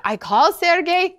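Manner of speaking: ordinary talk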